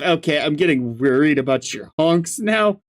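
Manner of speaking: usual speaking voice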